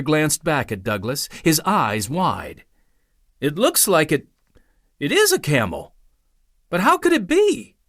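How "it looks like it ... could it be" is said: put-on a musing boy, rising high at the end; a lightly voiced young boy, wondering aloud; puzzled boy. A narrator's reading. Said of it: "he mused".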